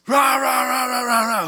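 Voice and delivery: high gibberish yelling rah rah; hammed-up angry babble; mock angry ranting. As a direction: rant nonsense like a furious spouse. Mimicking yelling mid-fight.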